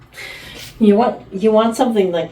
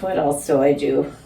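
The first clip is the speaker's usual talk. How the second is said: usual speaking voice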